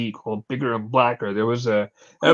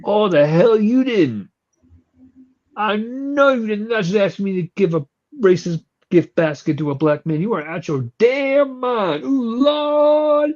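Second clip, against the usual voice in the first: outraged; 6 semitones higher than usual. Retold or imitated. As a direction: scold them in indignant fury. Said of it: "she lost her fucking mind".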